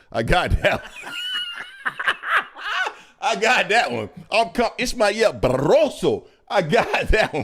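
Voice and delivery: laughing through a loud boast. Real, not acted. Host laughs, bragging to co-host that he got the name. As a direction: chuckle out the brag. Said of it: "I say that one with authority".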